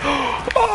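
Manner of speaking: quick high gasp; sharp excited gasp. Real, not acted. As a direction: gasp in sudden realization. Sudden idea in game.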